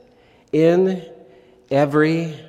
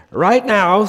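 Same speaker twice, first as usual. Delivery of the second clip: put-on a light, higher, louder stab at an Irish accent; hammed-up Irish pub caller declaring war; attempted Irish accent; 9 semitones higher, 6 dB louder than usual. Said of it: "needs an Irish accent".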